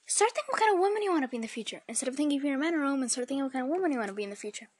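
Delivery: parroting dad at top speed; rapid repetition. Retold or imitated. Parodies dad's endless repeated line.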